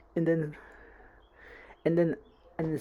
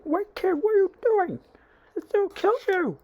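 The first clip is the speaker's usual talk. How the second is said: high, urgent, raised-voice cry; acted alarmed warning; 5 dB louder than usual. Heroes trying to stop Deku, acted alarmed shout.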